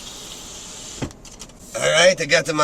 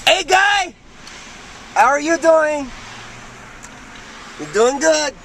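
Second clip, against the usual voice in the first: calling out; 10 semitones higher than usual. Real, not acted. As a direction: call across the street cheerfully. Calling out to passerby.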